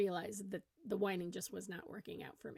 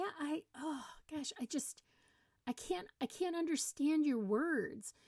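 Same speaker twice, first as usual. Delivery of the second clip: high, halting mock confusion; playing dumb; 6 semitones higher than usual. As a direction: falter as if you truly can't follow. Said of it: "try using the selectively stupid".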